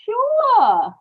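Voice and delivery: high, loud false reassurance; a sudden high chirp to cover the lie; forced bright sure. Acted. Wife covering a lie to her husband, high forced chirp.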